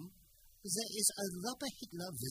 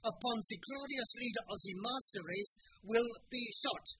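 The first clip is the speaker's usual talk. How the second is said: ranting dictator speech; 4 dB louder than usual. Acted. Gestapo officer threatens everyone.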